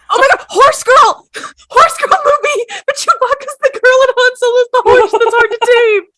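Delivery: high, crying-out excited pitch. Real, not acted. Pitching a Horse Girl movie to friends, giddy. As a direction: pitch the idea with giddy glee.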